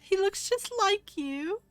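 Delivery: very high, loud gushing oh my; melting with joy. Acted. Queen seeing her brother's son, very high loud gushing. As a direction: gush as if about to melt. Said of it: "about to melt into a puddle".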